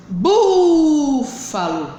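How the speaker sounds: "Búfalo" with a long, loud BÚ; slow BÚÚÚ-falo stress demo; stressed syllable drawn out. Shown as a demonstration. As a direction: push and hold the first syllable of "Búfalo", then let FALO fall. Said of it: "sílaba mais forte da palavra".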